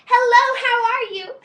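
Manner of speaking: a full loud high yell across the hallway; yelling hello. Retold or imitated. Belle yelling to a friend across the castle hallway, loud high shout. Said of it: "and I yelled at her".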